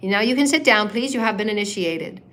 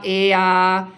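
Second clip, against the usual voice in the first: normal talking voice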